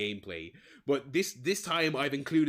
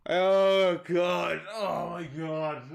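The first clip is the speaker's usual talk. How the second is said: laughing exclamation; 5 semitones higher, 4 dB louder, much slower than usual. Real, not acted. Recovering from laughter.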